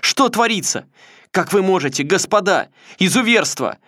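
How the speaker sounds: put-on high, loud boyish voice through tears; crying boyish shout. A narrator's reading. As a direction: cry out in a high boy's voice. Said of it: "кричал он сквозь слёзы тонким мальчишеским голосом". (тонкий мальчишеский крик сквозь слёзы)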